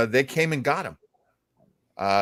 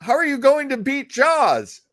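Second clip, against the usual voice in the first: much higher, hammed-up novelty-record question; mock interviewer; 12 semitones higher, 3 dB louder than usual. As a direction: ask it like a corny radio bit. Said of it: "And that was like".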